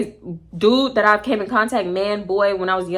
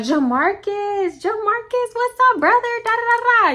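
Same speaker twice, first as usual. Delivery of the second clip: high singsong greeting, names drawn out; gushing friend; 11 semitones higher than usual. Retold or imitated. Girl greeting her "brother" Jamarcus, high mock gushing. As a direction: imitate her calling out sweetly.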